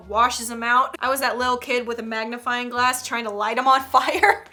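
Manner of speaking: usual speaking voice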